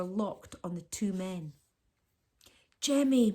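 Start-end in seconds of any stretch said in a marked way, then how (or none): none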